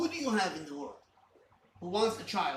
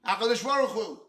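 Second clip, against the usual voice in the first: louder earnest appeal; 4 semitones higher, 7 dB louder than usual. Shown as a demonstration. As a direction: call out "HaKadosh Baruch Hu" louder and pleading. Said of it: "That's not what Avraham said".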